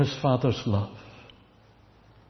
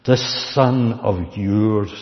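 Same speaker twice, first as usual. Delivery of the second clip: snarl; 6 dB louder, slower than usual. A narrator's reading. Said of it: "he snarls".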